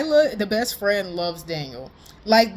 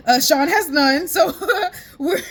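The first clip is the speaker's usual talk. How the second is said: words broken up by laughter; giggling through the words; cracking up at Sean having no game; laughing; 9 semitones higher, 4 dB louder than usual. Real, not acted. Laughing at Sean's lack of game.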